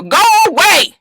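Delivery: high, loud yell through a door; yelling go away. Retold or imitated. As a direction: shout them off the porch.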